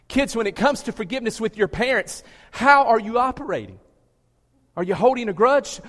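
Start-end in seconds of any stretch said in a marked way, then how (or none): 2.5-5.8 louder, pressing the question. Shown as a demonstration. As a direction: repeat it louder and higher, pushing.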